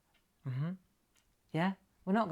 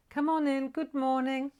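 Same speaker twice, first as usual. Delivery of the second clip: high chanted greeting; high lilting call to newcomers; sing-song welcome; 7 semitones higher than usual. Real, not acted. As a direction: sing out a welcome on held notes. Calls arriving viewers in.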